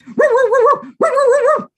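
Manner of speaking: high, loud yappy woo barks; excited high dog bark. Acted. Dog barking in story.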